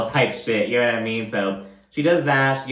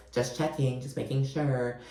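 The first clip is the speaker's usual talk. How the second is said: much softer, bitchy little retreat; passive-aggressive; 8 dB quieter than usual. Retold or imitated. Lisa backs off from accusing Whitney, softly passive-aggressive. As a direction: mimic a snide, quiet backing off. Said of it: "Just very passive-aggressive and just kinda bitchy".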